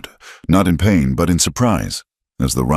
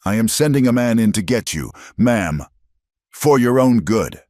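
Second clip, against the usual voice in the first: tight, frustrated; 7 semitones higher than usual. Acted. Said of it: "his voice tight with frustration".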